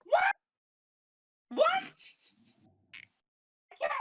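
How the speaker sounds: very high, very loud screeched reply; hideous screech. Acted. Hidden halfling behind the bar answers a summons, screeching. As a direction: screech it like a hard-of-hearing hag. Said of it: "a hideous screeching voice".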